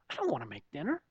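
soft, low mock sulk; acted-out quiet reluctance; reluctant grumble. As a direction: grumble it quietly to yourself, voice just audible. Repeats his reluctant inner grumble.

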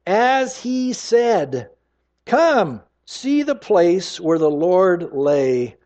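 drawn out, every word stressed; slow triumphant announcement. Acted. Angel telling the women at the tomb, slow and triumphant. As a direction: proclaim it slowly like heavenly news.

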